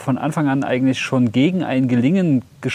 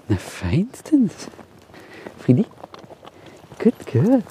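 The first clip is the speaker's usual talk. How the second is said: Hund loben; 5 semitones higher, slower than usual. Real, not acted. Hund wird gelobt.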